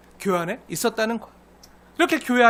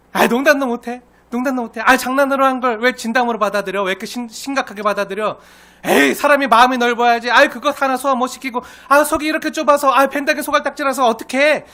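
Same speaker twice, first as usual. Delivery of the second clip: raised, high, needling complaint; mock scolding; 5 semitones higher, 6 dB louder than usual. Offender blaming hurt neighbour for taking a joke badly, scolding. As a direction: berate them for being oversensitive. Acting offender berating the victim.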